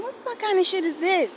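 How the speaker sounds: high, incredulous outburst; outraged disbelief. Retold or imitated. Telling viewers how she reacted to the police, high and incredulous. Retelling outrage at being committed.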